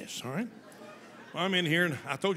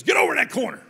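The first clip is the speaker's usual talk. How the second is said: high, loud command; barked order; 8 semitones higher, 9 dB louder, faster than usual. Retold or imitated. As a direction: bark it across the room. Principal barks order.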